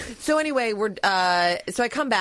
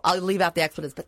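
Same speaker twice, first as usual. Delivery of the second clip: normal talking voice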